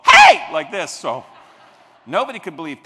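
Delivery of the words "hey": a huge high yell right behind a golfer; explosive "Hey!" at full volume; sudden shout. Shown as a demonstration. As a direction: startle someone with a shout. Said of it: "come up behind the guy ... and go".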